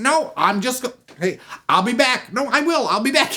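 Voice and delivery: high, loud stammering excuses; flustered groom. Acted. Groom making excuses to leave his honeymoon, flustered. Groom insists he will return.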